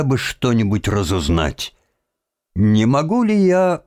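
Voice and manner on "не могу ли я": put-on higher-pitched, politely asking boy; polite request. A narrator's reading. Boy asking the cemetery watchman for a headstone, polite. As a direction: ask courteously, lifting the pitch. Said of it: "вежливо спросил он сторожа".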